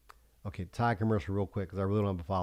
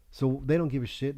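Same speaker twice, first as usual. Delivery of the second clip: ordinary talk, nothing marked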